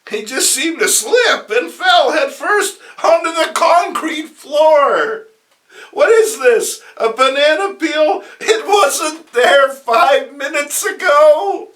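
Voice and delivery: high, loud breathless newscast; hammed-up live report; excited announcer. TV announcer shouting the news of the fall, high and loud.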